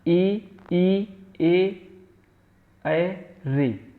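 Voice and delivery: vowels ई ए ऐ said slowly, each held clearly; vowel demo. Shown as a demonstration. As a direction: model each front vowel for students. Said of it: "अब किनके उच्चारण में?".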